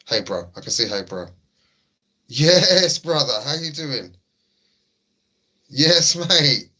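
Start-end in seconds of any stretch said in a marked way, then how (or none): 2.3-6.7 loud, high excited hello; booming welcome to a regular; hype greeting. Real, not acted. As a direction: greet him like a long-lost friend.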